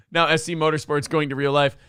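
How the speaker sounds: ordinary talk, nothing marked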